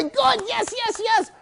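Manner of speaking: very high, squeaky cheering; excited encouragement. Shown as a demonstration. Trainer to dog far away, high excited encouragement. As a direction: urge the far-off dog home in quick bursts. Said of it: "If it's far away".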